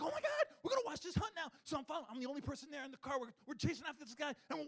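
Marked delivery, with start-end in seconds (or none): 0.0-1.5 excited. Retold or imitated.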